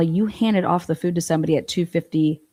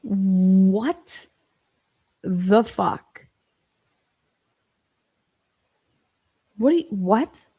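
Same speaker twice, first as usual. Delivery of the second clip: stunned disbelief; 2 semitones higher, much slower than usual. Real, not acted. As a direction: let the disbelief hang.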